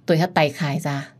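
ordinary delivery

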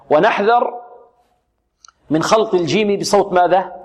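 ordinary delivery

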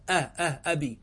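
"أه، أه، أبي" with the opening short "a" isolated and repeated; fatha on the hamza. Shown as a demonstration. Teacher showing where the fatha came from, "أه، أبي" sounded out from its first vowel. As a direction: sound out the glottal-stop "a" twice, then say "أبي". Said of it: "الفتحة التي كانت على الهمزة".

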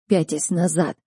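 plain reading voice

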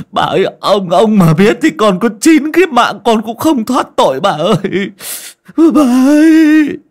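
high, loud panicked pleading; desperate begging. A narrator's reading. Kneeling servant loudly begs his mistress to spare him. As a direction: beg for mercy on your knees. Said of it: "mà van mà xin".

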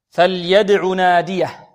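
فليدع slowly and loudly, with an echoing, bounced د; echoing dal. Shown as a demonstration. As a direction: stress the qalqala د in فليدع. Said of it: "عندنا الدال هنا ماذا؟".

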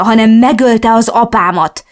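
hisztérikus üvöltés; hysterical yelling. Acted. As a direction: scream it out, beside herself. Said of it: "üvöltöttem hisztérikusan".

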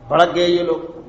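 usual speaking voice

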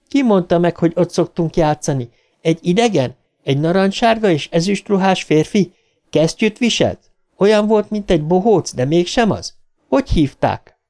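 put-on high-pitched girl firing questions; insistent daughter. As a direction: press your father with questions in a high voice.

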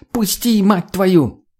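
high-pitched, frantic demand; acted furious yell at a closed gate; angry shouting. A narrator's reading. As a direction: cry out to be let through. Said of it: "закричала Тоня и забарабанила в калитку".

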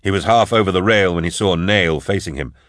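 ordinary delivery, nothing marked

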